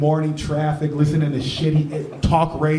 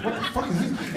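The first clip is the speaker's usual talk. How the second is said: softer, higher stunned question; recoiling in disbelief; shocked dad; 6 semitones higher, 8 dB quieter than usual. Retold or imitated. Dad staring at son's notebook, softly stunned. Dad finds dildo drawings.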